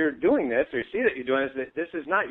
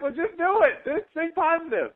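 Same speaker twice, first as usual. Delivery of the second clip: mock yelling back; 12 semitones higher than usual. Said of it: "they're yelling back".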